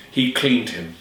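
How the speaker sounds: plain reading voice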